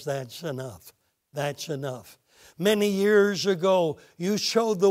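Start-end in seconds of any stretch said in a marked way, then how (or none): 0.0-2.2 hushed command. Acted. God stops Abraham gently.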